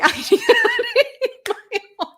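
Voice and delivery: high giggly imitation of a sobbing girl; laughing mock whine. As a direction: laugh while mimicking the crying daughter. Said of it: "she's crying".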